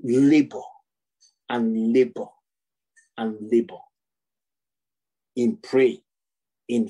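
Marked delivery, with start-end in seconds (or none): 0.0-3.8 low, heavy, weary stress on each "labor". Acted. Preacher hammering the word "labor" to mock striving.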